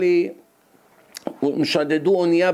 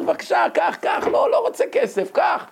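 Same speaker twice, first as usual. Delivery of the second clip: panicked shout; 12 semitones higher than usual. Retold or imitated. Korean shopkeeper pleading with robber, shouting scared. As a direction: yell it out in terror. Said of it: "הוא מרוב פחד".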